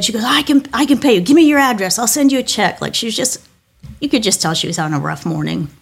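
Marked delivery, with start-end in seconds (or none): 0.2-2.8 upset stranger insisting. Retold or imitated. Upset woman insisting on repaying.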